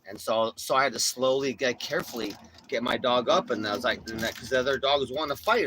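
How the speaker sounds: usual speaking voice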